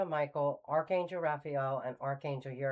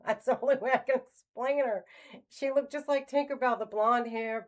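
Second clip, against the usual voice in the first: high, loud and laughing; 8 semitones higher, 5 dB louder than usual. Real, not acted. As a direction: laugh your way into the words, pitch lifted. Amused laugh describing her as Tinkerbell.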